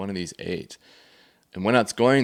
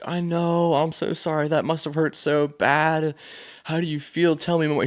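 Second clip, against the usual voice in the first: higher, gentle comforting dad; tender dad; 8 semitones higher than usual. Retold or imitated. Dad consoling his daughter, gentle and tender.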